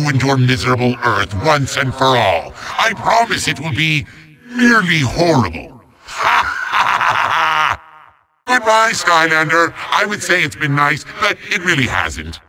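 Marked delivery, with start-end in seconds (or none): 6.1-7.7 long theatrical villain cackle; evil laugh. Acted. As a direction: cackle in gloating triumph. Villain gloating at the hero.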